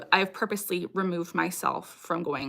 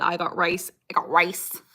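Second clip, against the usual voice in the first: imitating a nasal-voiced speaker; nasally voice; 2 semitones lower than usual. Retelling an ex-boyfriend's catchphrase, nasally voice. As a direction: quote it lightly through the nose. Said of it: "in a nasally voice".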